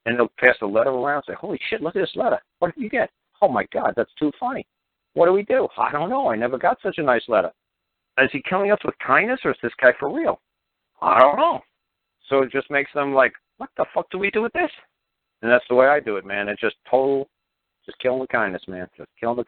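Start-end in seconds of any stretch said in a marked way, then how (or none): 1.3-11.6 high-pitched mock astonishment; amazed bafflement. Agents passing the nice letter around, amazed and baffled. 13.6-14.7 high, thin-voiced baffled question; mock bewilderment. Agents puzzling over a kind letter, bewildered.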